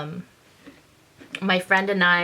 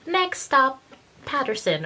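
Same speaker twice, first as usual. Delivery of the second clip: high announcer call of the stop; mock SkyTrain announcer; transit announcement; 6 semitones higher than usual. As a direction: imitate the train's stop announcement. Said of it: "she heard the SkyTrain".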